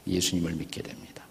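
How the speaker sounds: hushed whisper on 예수님을 with a falling end. Shown as a demonstration. Preacher hushing the name 예수님을 to the congregation, whispered. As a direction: breathe out 예수님을 믿게 됩니다 as a soft whisper. Repeating the confession of faith.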